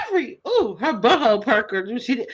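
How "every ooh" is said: mock voice crack. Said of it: "she hit a [name] note".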